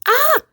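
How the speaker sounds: sudden high shriek of shock; startled shriek. Retold or imitated. Retelling her waking in his flat, a high startled shriek. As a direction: yelp in alarm on waking. She wakes up in his apartment.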